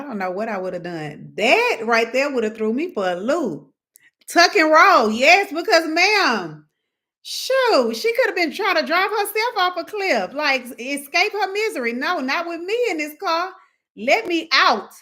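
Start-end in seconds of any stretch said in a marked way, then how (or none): 4.3-14.9 higher and louder, half-laughing alarm; panicked excitement. Pretend. A passenger stuck with the wife driving, excited panic. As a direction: raise it up like you're trapped in the car. Imagining escape from the car.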